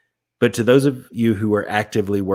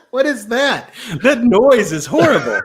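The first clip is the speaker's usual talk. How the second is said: laughing; 11 semitones higher, 3 dB louder, slower than usual. Real, not acted. Laughing at partner's robot voice.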